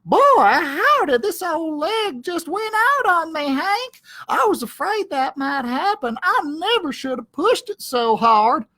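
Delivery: high, put-upon little dog voice; milk the fake limp for sympathy; lazy sidekick dog faking an injury; whiny Drover.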